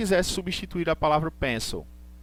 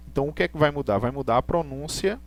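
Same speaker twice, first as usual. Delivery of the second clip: usual speaking voice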